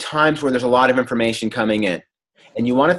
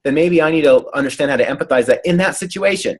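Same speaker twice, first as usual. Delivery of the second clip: normal talking voice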